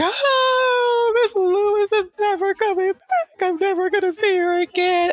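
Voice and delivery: high-pitched mock bawling of an overcome child; imitated tearful wail. Daughter breaking down over teacher leaving, imitated tearful wailing. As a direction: wail it like a kid who can't hold it in. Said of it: "the emotion is just overcoming her".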